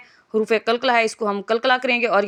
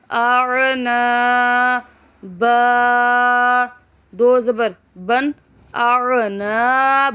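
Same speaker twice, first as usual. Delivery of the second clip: slow chant, letters drawn out then joined; Qaida spelling drill; much slower than usual. Shown as a demonstration. Teacher chanting letters for pupils, drawn-out sing-song spelling. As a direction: spell it out for a child learning Quran letters.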